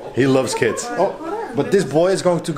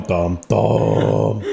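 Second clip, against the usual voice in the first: normal talking voice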